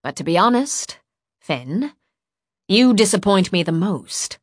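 weary but firm rebuke; understated, let-down reproach; tired disappointment. A narrator's reading. Woman turns to the boy she trusted, tired disappointment. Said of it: "she just sounded tired".

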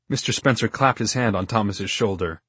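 ordinary delivery

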